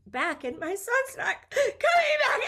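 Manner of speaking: choked-up, high, slow quote; tearful retelling. Retold or imitated. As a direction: voice rising high and breaking near tears. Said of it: "I said".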